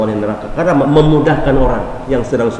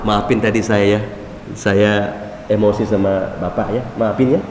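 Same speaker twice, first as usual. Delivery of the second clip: sheepish apology; 5 semitones lower, 5 dB quieter than usual. Retold or imitated. Quick-tempered man apologising.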